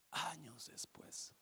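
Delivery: slow hushed storyteller; whispered narration. A narrator's reading. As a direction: breathe the time passing. Narración en susurro. (narración susurrada)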